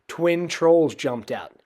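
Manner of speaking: usual speaking voice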